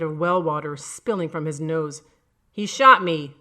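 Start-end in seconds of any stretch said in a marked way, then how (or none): 2.5-3.4 loud accusing cry from the jury; called out. A narrator's reading. Dead juror calls out loudly at the accused. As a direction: shout the accusation across the courtroom. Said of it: "another called out".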